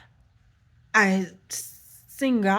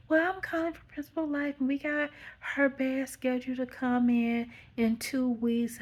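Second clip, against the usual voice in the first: lightly voiced phone agent, higher pitched; a hint of a higher office-call voice; insurance caller; 6 semitones higher than usual. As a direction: imitate a caller explaining arrangements.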